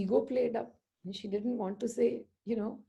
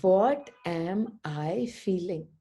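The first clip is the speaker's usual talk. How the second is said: very slow, spaced-out delivery; posed question; 3 semitones lower, 3 dB louder, much slower than usual. Shown as a demonstration. Speaker tells listeners what to ask, slow and deliberate. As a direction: draw every word out for the listeners. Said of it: "You ask this question".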